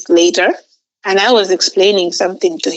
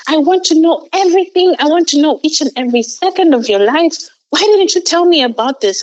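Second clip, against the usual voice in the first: retold furious yell, much higher and fast; angry swearing; 8 semitones higher than usual. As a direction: rant at her, fast and high-pitched with fury. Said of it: "then he got so angry".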